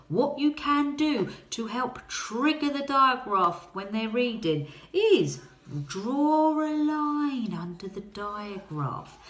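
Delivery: ordinary talk, nothing marked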